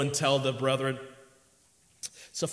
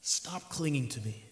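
much softer, quiet and calm; gentle rebuke; 9 dB quieter than usual. Acted. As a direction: say it softly, almost to her alone. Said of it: "the Lord makes this comment".

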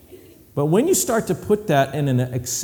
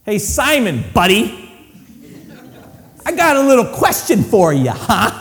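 confrontational; 5 semitones higher, 4 dB louder than usual. Retold or imitated. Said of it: "I would be saying this".

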